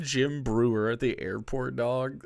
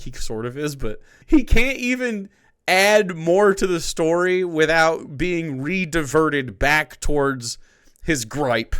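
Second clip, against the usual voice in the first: ordinary talk, nothing marked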